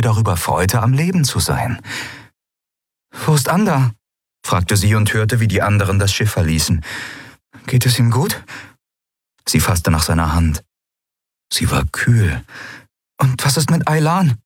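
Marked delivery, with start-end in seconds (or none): 3.2-14.4 higher urgent worried questions; anxious. A narrator's reading. Endriel asking Kai about her friends, anxious. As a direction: press him anxiously for news.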